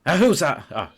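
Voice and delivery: loud, high jolt of surprise; acted startled outburst, settling quickly. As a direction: jump at an unexpected caller. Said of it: "she jumps, like, when she hears you".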